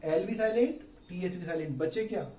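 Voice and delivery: ordinary talk, nothing marked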